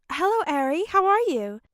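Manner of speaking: put-on high, bright-toned greeting to a child; cheery and warm. A narrator's reading. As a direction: switch to cheery for the little girl. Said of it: "cheery and warm".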